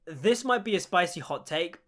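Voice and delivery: usual speaking voice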